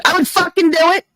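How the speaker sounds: retold high fast outburst of frustration; angry outburst. Telling chat what she snaps at her friends, in an exasperated high outburst. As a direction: fire it back high and fast, fed up. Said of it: "I get mad 'cause I'm like".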